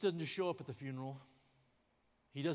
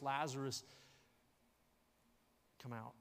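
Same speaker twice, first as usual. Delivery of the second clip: normal talking voice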